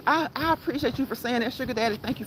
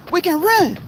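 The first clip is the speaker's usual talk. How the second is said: excited; 7 semitones higher, 7 dB louder than usual. Real, not acted. Lists exercises while walking.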